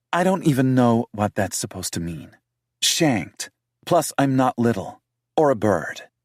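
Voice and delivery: defensive British woman voiced high; heroine's voice. Heroine's lines.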